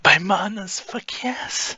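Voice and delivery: high, breathy exclamation of pleasure; high gasping yes; ecstatic cry. Acted. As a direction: cry out in bliss. Character crying out in ecstasy.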